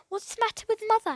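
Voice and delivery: put-on quick, high, worried boy; anxious question. Philip asks his aunt about his sick mother, anxious. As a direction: blurt it out in alarm.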